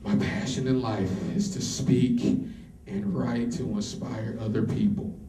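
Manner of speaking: grand heroic declaration. Retold or imitated.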